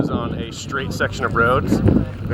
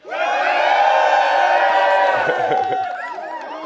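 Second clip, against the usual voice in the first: big whooping laugh. Real, not acted. Cheering on a video greeting.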